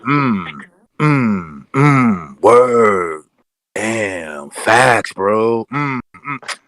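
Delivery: exaggerated agreeable humming, yes-man style; mock approving hums. Mocking the fawning interviewer with agreeable hums. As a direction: hum along like a fawning interviewer.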